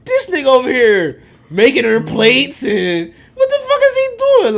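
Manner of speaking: loud, laughing, high disbelief. Retold or imitated. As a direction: half-shout it through laughter. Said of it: "I'm like".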